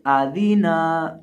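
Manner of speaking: Adina dragged out very slowly; slow wrong stretch. Shown as a demonstration. Teacher demonstrating the wrong reading: Adina dragged slowly. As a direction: drag every vowel of Adina. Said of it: "इस तरह नहीं".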